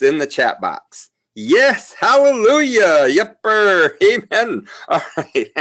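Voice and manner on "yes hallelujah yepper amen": high, loud hallelujah cheer; a playful amen shout; mock revival cheer. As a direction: whoop it up like a revival crowd. Cheering the chat's yes answers.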